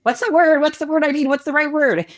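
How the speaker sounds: high-pitched mock fretting; flustered beginner. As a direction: imitate someone stuck searching for an answer. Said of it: "people will stand there like".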